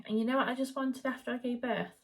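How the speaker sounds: ordinary talk, nothing marked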